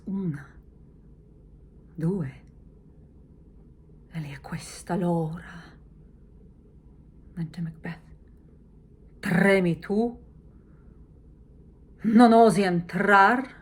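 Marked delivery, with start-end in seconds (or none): 0.1-5.6 hushed and eager counting; excited whisper. Shown as a demonstration. Lady Macbeth whispering as the clock strikes. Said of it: "she's excited". 9.2-13.5 loud, slow jeering challenge; taunting. Shown as a demonstration. Lady Macbeth taunting Macbeth for trembling.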